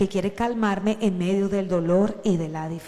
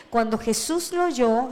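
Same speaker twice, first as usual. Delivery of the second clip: usual speaking voice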